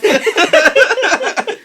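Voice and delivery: high, loud complaint breaking into laughter; giggling imitation of a stuck golfer; laughing mock complaint. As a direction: whine it out while cracking up. Imitating Blake, laughing through it.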